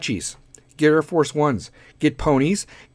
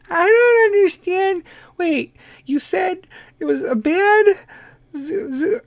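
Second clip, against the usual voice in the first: Kim Kardashian voice; 13 semitones higher than usual. Pretend. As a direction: play a confused Kim, pitched way up. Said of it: "people like when I do the Kardashian voices".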